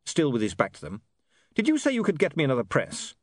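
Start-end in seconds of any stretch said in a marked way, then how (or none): 1.5-3.1 raised-pitch pointed question; pointed, higher-pitched query; sharp. Acted. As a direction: demand an answer, mildly sharp. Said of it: "said William sharply".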